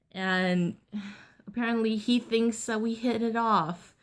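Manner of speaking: ordinary talk, nothing marked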